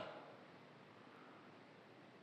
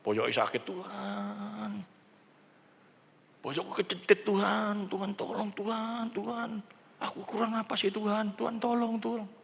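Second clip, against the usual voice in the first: soft, higher, drawn-out imitation of pleading in pain; hushed, insistent mock plea; wife's pleading prayer; 5 semitones higher, 7 dB quieter than usual. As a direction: mimic a wife begging God for help. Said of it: "Istri saya gitu, misalkan".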